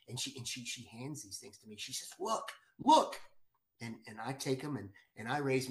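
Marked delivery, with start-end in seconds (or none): 2.2-3.2 much higher and louder, urgent; urgent excited cry. Retold or imitated. Wife urges him to look through the goggles, urgent cry.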